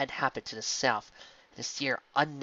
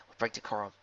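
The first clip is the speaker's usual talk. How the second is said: plain reading voice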